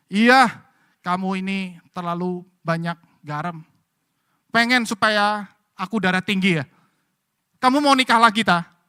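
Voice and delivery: grumbling husband. Retold or imitated. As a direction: scold the wife over the salty food. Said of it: "dia bilang begini". (suami mengomel keras)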